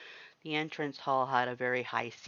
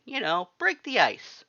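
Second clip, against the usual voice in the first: a sly grin in the voice, higher pitched; grinning Sans voice; 8 semitones higher, 9 dB louder than usual. Acted. Sans coaxes the new hire with a grin. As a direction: tease the newcomer with a grin.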